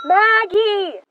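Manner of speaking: high call across the house. Acted. Emma calling after the maid who has left. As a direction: call for her through the rooms.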